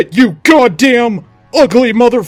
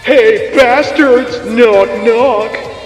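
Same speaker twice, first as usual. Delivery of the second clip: loud, higher-pitched call; taunting yell; 6 semitones higher, 5 dB louder, much slower than usual. Acted. Attacker announcing himself over a loudspeaker, yelling. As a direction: yell it out to the enemy. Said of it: "I yelled".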